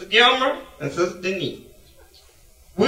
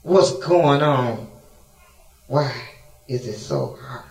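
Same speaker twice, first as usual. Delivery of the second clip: slow anguished question; 7 semitones lower than usual. Acted.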